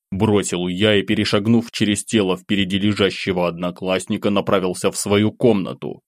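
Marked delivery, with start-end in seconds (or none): none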